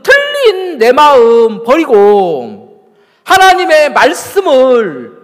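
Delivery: loud, high, drawn-out pulpit delivery; forceful preaching. Real, not acted. Preacher putting a challenge to the congregation, forceful preaching. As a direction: drive each phrase home at full voice.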